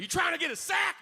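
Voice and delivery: shouted dealer greeting. Retold or imitated. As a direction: bark the offer up high across the yard. Dealer calling out to him.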